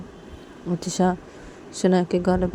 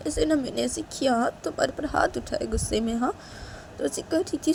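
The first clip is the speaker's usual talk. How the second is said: soft, pleading apology; aching, quiet concern for the hurt one; remorseful, loving Disha; 7 semitones higher, 5 dB quieter than usual. Acted. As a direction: explain and vow tenderly, sinking toward a whisper.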